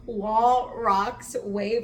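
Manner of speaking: loud, punched single words; emphatic listing. Retold or imitated. Friend points out dangers on the rocks, loud emphatic listing. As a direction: punch each word out loudly, imitating him.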